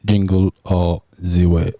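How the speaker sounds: low, slow mock Austrian accent; Arnold Schwarzenegger. Answering the favorite movie question in a mock Austrian accent. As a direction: put on a thick Austrian accent. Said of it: "Is that an Arnold impersonation?".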